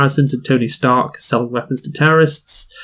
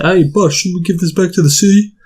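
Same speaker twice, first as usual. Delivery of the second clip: mock henchman; 6 semitones higher, 3 dB louder than usual. Henchman voiced asking the boss.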